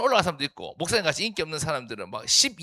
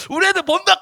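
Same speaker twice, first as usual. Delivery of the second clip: shrill, much higher, loud frantic yell to take it down; panicked yelling; 10 dB louder than usual. Retold or imitated. Pastor yelling at his friend to take the old photo down, frantic shout. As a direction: scream at the friend to delete the photo now.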